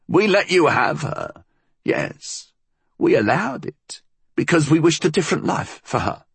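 put-on high, sharp old woman; old crone voice.

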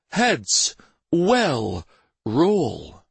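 high, word-by-word royal threat; menacing pauses. Acted. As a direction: drop each word separately, with weight. Prince threatens councillors after pause.

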